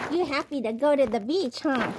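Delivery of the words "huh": normal talking voice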